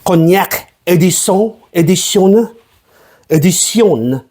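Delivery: mock French accent, edition said ee-dee-see-on. Shown as a demonstration. Host trying out cognac edition, mock French accent. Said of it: "saying English words in a French fucking accent".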